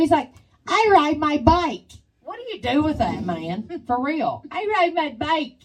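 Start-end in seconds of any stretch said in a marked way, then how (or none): 0.7-1.9 slow, loud, high mimicked boast; imitated kid's accent. Kid shows off his bike to her, slow mimicked accent. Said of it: "It's like his accent". 4.5-5.6 high mimicked announcement; imitated kid's accent. Kid with a bike tells her, high mimicked accent. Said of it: "It's like his accent".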